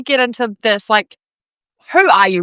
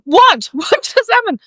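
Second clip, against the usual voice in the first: retold shocked question; 9 semitones higher than usual. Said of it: "I was like".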